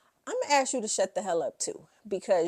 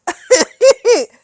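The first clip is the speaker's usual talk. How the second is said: loud high burst of laughter; 21 dB louder than usual. Real, not acted. Laughing at Camille.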